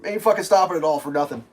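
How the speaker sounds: ordinary talk, nothing marked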